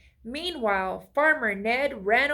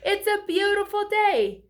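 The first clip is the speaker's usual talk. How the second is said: high, loud and bright call; joyful call; 10 semitones higher, 5 dB louder than usual. A narrator's reading. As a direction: announce the lovely day gladly. Scarecrow calling friends.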